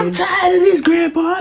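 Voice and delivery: high, strained mock whine; mock whiny girl. Mocking her sister whining to Grandpa, high and strained. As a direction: whine it like a fed-up kid to Grandpa.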